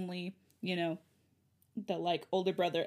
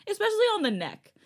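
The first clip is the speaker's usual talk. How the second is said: indignant; 5 semitones higher, 5 dB louder than usual. Real, not acted. Outraged objection.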